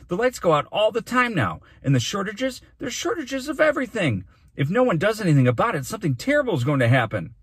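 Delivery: high, loud outburst, speeding up at the end; urgent cry. A narrator's reading. Boy jumps up to warn of disaster, urgent cry. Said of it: "cried Dune".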